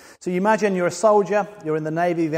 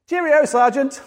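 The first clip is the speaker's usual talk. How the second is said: breezy cheerio, much higher and louder; cheery farewell; 9 semitones higher, 6 dB louder than usual. Retold or imitated. Discharged soldier cheerfully brushing off the sergeant, breezy. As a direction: wave him off cheerfully.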